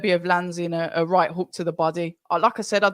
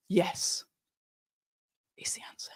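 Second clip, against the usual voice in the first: hushed whisper; 11 dB quieter than usual. Shown as a demonstration. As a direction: breathe it out unvoiced. Repeats answer, then whispers it.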